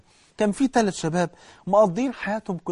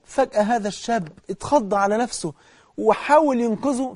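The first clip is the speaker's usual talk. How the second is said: normal talking voice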